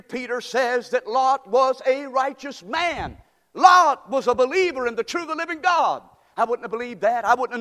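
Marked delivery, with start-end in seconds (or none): 0.0-6.0 preaching shout. Acted. Preacher shouting to the congregation about Lot, full force. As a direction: shout the truth to the back row.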